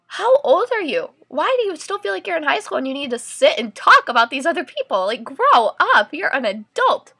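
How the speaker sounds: scornful scolding of gossiping mothers; exasperated rant. Retold or imitated. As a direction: tell them off, outraged. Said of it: "so that was my soapbox".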